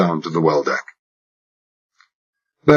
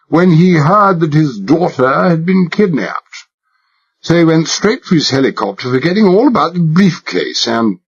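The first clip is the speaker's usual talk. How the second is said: put-on high-class English accent laid on thick, higher; exaggerated British accent; 9 semitones higher than usual. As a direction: play the posh Englishman to the hilt. Narrator voices himself as posh Englishman.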